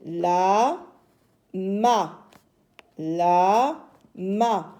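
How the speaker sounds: "La, ma" split with long pauses, "La" drawn out and rising; teacher's slow two-beat "La, ma", repeated; syllable by syllable. Shown as a demonstration. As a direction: sound out "La", then "ma", separately. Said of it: "nous avons deux syllabes".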